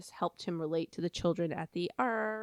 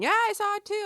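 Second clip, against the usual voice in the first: put-on high, louder eager child chiming in; a kid jumping in to agree; mock eager kid; 8 dB louder than usual. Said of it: "everybody else going".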